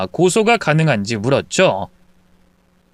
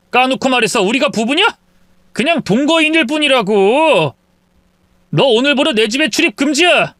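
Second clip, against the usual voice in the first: angry husband; 9 semitones higher than usual. Acted. Husband banning his wife from the house, yelling. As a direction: shout her down.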